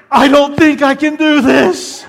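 put-on high, slow, loud frightened kid voice; terrified child on a coaster; scared kid. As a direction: squeal it like a scared boy. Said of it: "he goes".

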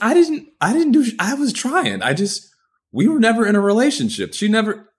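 high, loud flustered protest; defensive protest. Retold or imitated. Guy pressed about breaking a heart, high loud defensive protest. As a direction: splutter the denial in a high, loud voice.

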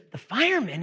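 high-pitched, incredulous echo; startled salesman. Acted. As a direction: repeat it back high, as if astonished. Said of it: "there's a salesman on there".

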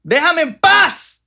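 high, slow whine rising to a sobbing shout; building desperate whine. Acted. Begging salespeople to stop.

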